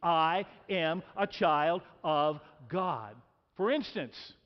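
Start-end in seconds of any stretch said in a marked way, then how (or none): 0.0-3.2 slow and deliberate, every word weighted; slow, emphatic declaration. Shown as a demonstration. Preacher leading the congregation, slow weighty declaration. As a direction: plant each word slowly like a creed. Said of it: "Say it with me".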